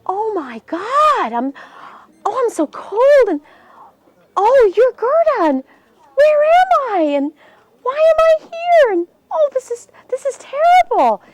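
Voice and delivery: put-on high, rising, near-crying boy; boy waking in distress. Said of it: "And he goes".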